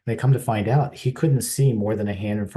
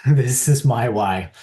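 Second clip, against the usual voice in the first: slow, loud, with a laugh; a proud laugh, then land it slowly; laughing reveal; 4 semitones higher, 9 dB louder, slower than usual. Real, not acted. Reveals his personal motivation, laughing.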